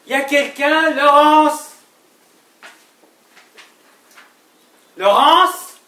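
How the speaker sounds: loud, high holler to an unseen person; shouted calling. Acted. Husband searching for his wife Laurence, shouting for an answer. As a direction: call out hard, stretching the name upward. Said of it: "pourquoi est-ce que tu cries".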